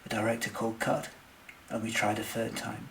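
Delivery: ordinary delivery, nothing marked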